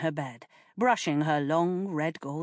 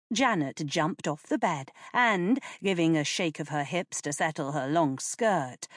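plain reading voice

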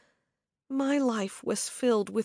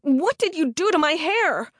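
distraught demand; 6 semitones higher, 5 dB louder than usual. A narrator's reading. Demanding of attacker.

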